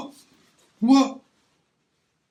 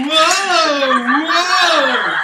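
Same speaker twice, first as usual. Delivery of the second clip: excited whoa; 3 semitones higher than usual. Real, not acted. Host reacts to cigar smoke.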